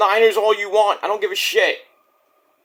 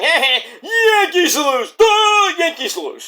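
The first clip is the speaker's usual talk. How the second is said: high, slow dopey gloating with a chuckle; a goofy, hammed-up Yankees taunt; 10 semitones higher, 3 dB louder, slower than usual. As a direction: play the dumb gloater mocking rivals.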